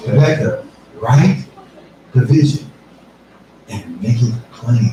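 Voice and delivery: slow, spaced-out "write the vision"; each phrase set apart with pauses; paced emphasis. Shown as a demonstration. Preacher repeating God's words to Rebecca, slow and spaced. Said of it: "repeats God's words slowly".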